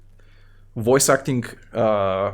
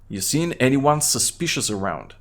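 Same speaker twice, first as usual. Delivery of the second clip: usual speaking voice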